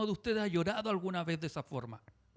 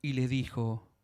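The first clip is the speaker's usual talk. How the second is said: compassionate; 7 semitones lower, slower than usual. Retold or imitated. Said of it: "se compadeció de ella".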